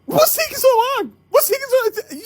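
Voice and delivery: high-pitched impatient whine; exasperated. Retold or imitated. Waiting caller fretting over the pizza, exasperated. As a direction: play up the impatience in a squeaky high voice.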